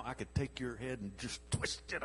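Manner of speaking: low, soft seething threat under the breath; seething mutter. Retold or imitated. Pastor voicing Samson, holding rage in, quiet seething threat. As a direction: hold the rage in, barely said. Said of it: "I'm so mad right now".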